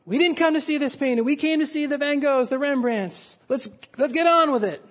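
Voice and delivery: retold shout of an annoyed bidder; impatient shout. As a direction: heckle the auctioneer from the back. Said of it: "Another voice shouted".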